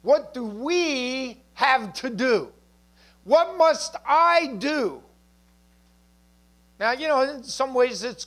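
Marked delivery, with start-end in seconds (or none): 0.0-2.5 "What do we have to do" drawn out slowly; slow, weighty stress on "we have to do"; emphatic stress. Shown as a demonstration. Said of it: "you hear the emphasis". 3.2-5.0 "What must I do" slow, high and punched; heavy, drawn-out stress on "must I do"; emphatic stress. Shown as a demonstration. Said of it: "you hear the emphasis".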